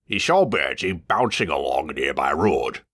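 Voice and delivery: usual speaking voice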